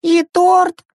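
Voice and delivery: put-on high-pitched dismayed little boy; about to bawl. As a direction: ask like a kid close to tears. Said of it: "скривился Вова, собираясь зареветь".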